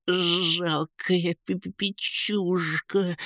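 high, slow, shivering stammer; stuttering with cold. A narrator's reading. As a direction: chatter it out angrily as if freezing. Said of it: "заикаясь от холода, рявкнул Кейн". (заикаясь от холода)

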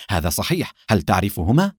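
ordinary delivery

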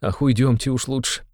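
high, worried urging; frightened. A narrator's reading. Mother wants to leave her raving son, frightened. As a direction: urge it anxiously, pitched high. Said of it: "перепугалась мать".

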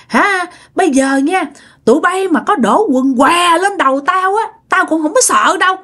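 high-pitched threatening ghost; possessing spirit. Retold or imitated. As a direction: warn them off as an angry spirit. Spirit threatens the villagers again. (giọng vong hăm dọa)

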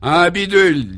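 both brothers scandalised at the rumour; hammed-up indignation; indignant outcry. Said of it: "dirent-ils ensemble".

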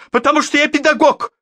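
very high, loud shrill-pitched retort; offended teacher. A narrator's reading. Class teacher answers the major, high and offended. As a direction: fire it back with wounded pride.

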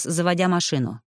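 ordinary delivery, nothing marked

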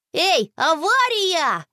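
high, drawn-out cry of alarm; narrated boy's wail; sudden yell. A narrator's reading. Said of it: "вдруг завопил Боря".

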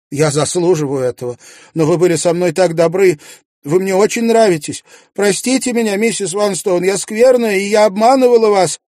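high, loud weeping plea for forgiveness; tearful confession. A narrator's reading. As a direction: sob out a guilty apology. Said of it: "она заплакала". (плачущая, высоким громким голосом)

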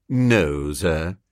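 low, measured manservant; respectful but firm. A narrator's reading. Jeeves refusing his employer, respectfully firm. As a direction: decline politely in a low voice. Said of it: "He spoke respectfully, but firmly".

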